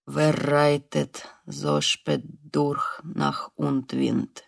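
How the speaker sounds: low, very slow German recitation; obedient recitation. Acted. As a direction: recite the poem slowly, weakly. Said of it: "послушно начал он". (медленно декламирует по-немецки)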